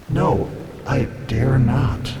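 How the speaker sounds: slow, drawn-out refusal; slow, reluctant castaway's voice; stifled craving. A narrator's reading. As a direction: refuse while holding back longing. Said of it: "in its tones I detected a note of stifled craving".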